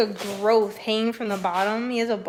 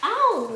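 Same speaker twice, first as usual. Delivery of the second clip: sudden ow; 9 semitones higher, 5 dB louder than usual. Real, not acted. Hurt by dog.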